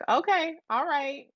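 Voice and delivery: a touch of a friend's reply. Retold or imitated. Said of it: "she was like".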